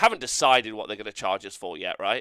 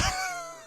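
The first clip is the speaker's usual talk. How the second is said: high cackle. Acted. Puppet Shilly cackling.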